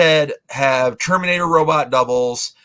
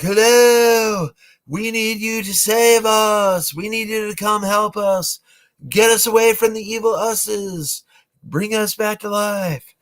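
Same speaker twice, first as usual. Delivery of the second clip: ghostly plea; 7 semitones higher than usual. Retold or imitated. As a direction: float it out like a spirit at a seance. Astral ghosts speak at seance.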